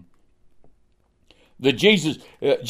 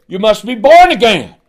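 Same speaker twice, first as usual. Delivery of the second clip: forceful retort; 6 semitones higher, 7 dB louder than usual. Retold or imitated. Preacher retells his loud reply.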